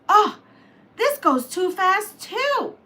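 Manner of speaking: put-on high, loud little girl complaint; a girlish whine about the machine; Curly Locks voice. Storyteller voicing Curly Locks, high and fussy. Goldilocks parody voiced character.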